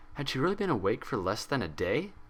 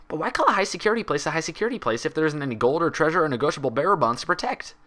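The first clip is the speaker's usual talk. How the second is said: eager Pinkie; 8 semitones higher, 5 dB louder than usual. Acted. Pinkie voiced in story.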